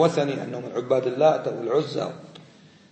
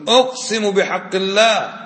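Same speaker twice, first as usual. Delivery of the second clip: slow, ringing, raised-voice oath in imitation; emphatic oath; 5 semitones higher, slower than usual. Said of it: "بعض الناس يقول". (قَسَم بصوت عالٍ ممدود)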